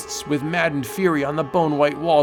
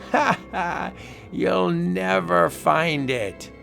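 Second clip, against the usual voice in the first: mocking taunt; 6 semitones higher, slower than usual. Mocking guards.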